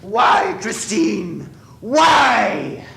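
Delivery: loud, high cry of betrayed fury; anguished shout. Acted. Eric to Christine after she unmasks him, anguished shout. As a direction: scream it at her in raw hurt.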